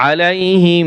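"আলাইহিম" ending in a long humming m; ghunnah. Shown as a demonstration. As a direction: hold the final m of আলাইহিম as a nasal hum. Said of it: "গুণ্য হবে".

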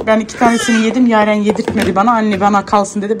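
usual speaking voice

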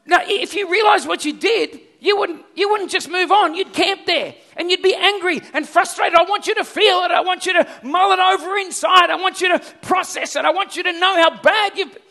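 angry lecture. Retold or imitated. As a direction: drive the point home, shouting. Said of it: "I flared up about it".

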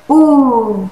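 loud, long "ऊ" with a falling tune; drawn-out teacher's "ऊ"; long vowel demo. Shown as a demonstration. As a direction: hold "ऊ" out, sliding down. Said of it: "आप उसे इस तरह से सिखाएं".

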